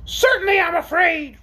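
high-pitched creature admission; frankly afraid orc. Acted. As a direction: admit fear openly in a high orc voice. Orc admits fear in figure voice.

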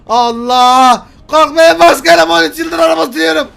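loud, high cry of alarm; frantic yelled reassurance; panicked shouting. Pretend. Driver to his boss as the car crashes, panicked shouting.

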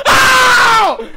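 long, high scream of fright; frightened scream. Real, not acted. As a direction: scream out in sudden shock. Jump scare cuts the sentence.

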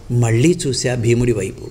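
usual speaking voice

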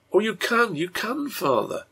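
put-on high, insistent little-girl voice; small daughter urging him on; pleading child. A narrator's reading. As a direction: beg father in a high child's voice.

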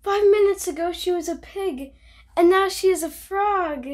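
astonished Gerald. Acted. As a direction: exclaim it as if it's unbelievable. Gerald shocked, line after.

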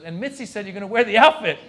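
loud, high, climbing delivery; gleeful announcement. Acted. Booker telling comic about outfit, loudly enthusiastic. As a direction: announce it big, driving up to the last word. Booker springs bad news.

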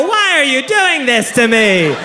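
high, drawn-out mock wail; hammed-up despairing protest; anguished wail. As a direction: plead like a tormented victim, stretching it out. Victimized passenger wails.

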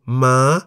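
"ma" held in a high, rising Thai tone. Shown as a demonstration. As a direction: say "ma" high, lifting at the end. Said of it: "Ma is in the high tone".

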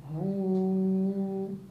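हू stretched one second; madd held. Shown as a demonstration. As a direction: hold हू long. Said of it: "वक्फ".

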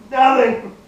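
high, loud yell. Pretend. Gamer playfully yelling at a game character, yelling. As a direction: yell it out at him. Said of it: "Just yell at him".

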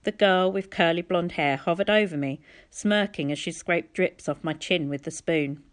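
plain reading voice

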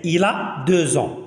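slow model of deux ans said 'deu-zan'; deux ans joined by a z, unhurried; liaison in z. Shown as a demonstration. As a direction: link deux to ans with a clear z. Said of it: "هاد اكس كتصبح زد".

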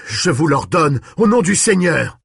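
high, rising to a shout; shouted command. A narrator's reading. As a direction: order with desperate force. Priest commands the vampire.

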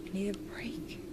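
breathy whisper, no voice at all; exhausted whisper of need; whispered plea. Real, not acted. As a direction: whisper it to yourself, worn out.

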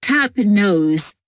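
ordinary talk

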